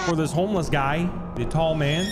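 normal talking voice

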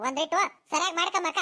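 usual speaking voice

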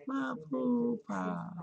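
put-on baby-talk coo of poo-pah; cooing pet name. Shown as a demonstration. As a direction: croon the nickname to the pet. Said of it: "Wanted y'all to see my poo-pah".

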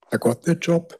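ordinary talk

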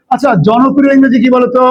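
usual speaking voice

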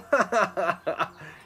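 loud jeering laugh; a bully's taunting cackle; mocking laugh. A narrator's reading. Bully laughing at Trisha in her hiding place, jeering laugh. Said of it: "He laughed".

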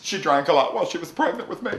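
usual speaking voice

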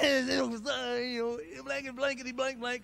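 loud tough-guy cussing, half laughing; cussing shout. Retold or imitated. Driver yelling curses from his car, loud and rough. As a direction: yell it out to feel tough. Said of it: "I was like".

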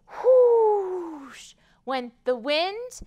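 slow, drawn-out whoosh; stretched whoosh. Acted. Librarian blowing the wind for the children.

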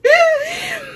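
very high giddy laugh; squealing laugh; high giggle. Real, not acted. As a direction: giggle at your own joke. Laughing after falsetto line.

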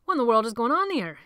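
shocked question. A narrator's reading. Shocked stranger asks.

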